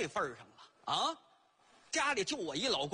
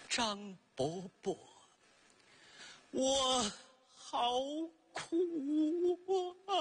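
word by word, halting ghostly moan; mournful ghost; 2 semitones higher, slower than usual. Acted. Ghost pot complains of its suffering, halting moan. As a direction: drag out each word like a suffering spirit. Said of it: "就听这盆儿说".

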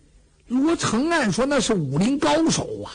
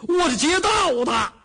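bandit's shout; 6 semitones higher than usual. Acted. Bandit declares himself to Luo Cheng.

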